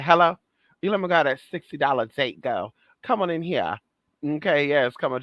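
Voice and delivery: usual speaking voice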